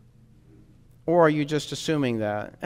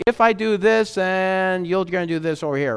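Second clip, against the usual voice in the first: higher-pitched wheedling bargainer; mock negotiating; 6 semitones higher, 4 dB louder than usual. You sit there and negotiate with God, mock bargaining. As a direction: act out someone proposing a deal, pitched up. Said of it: "you sit there and negotiate with God".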